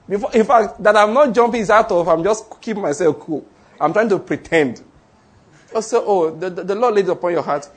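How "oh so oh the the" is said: ordinary talk, nothing marked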